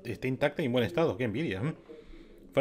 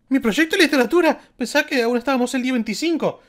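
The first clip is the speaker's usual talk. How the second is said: high, loud panicked schoolgirl cry; 12 semitones higher, 10 dB louder than usual. Acted. Streamer voicing Kyoko's forgotten project panic, high and loud. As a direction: Kyoko suddenly remembers her homework, shrieking. Voices Kyoko remembering homework.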